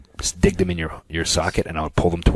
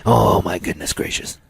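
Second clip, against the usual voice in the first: announcer voice; 4 semitones higher, slower than usual. Acted. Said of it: "That's my announcer voice".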